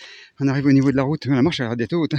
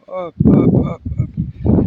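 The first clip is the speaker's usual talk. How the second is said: high squeaky hop hop; playful hops; 5 dB louder, much slower than usual. Pretend. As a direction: bounce each hop up high. Mimics hopping around mountain.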